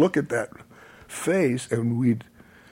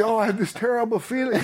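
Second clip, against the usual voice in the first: laughing; 11 semitones higher, faster than usual. Real, not acted. Laughing while retelling the proposal.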